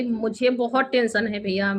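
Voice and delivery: usual speaking voice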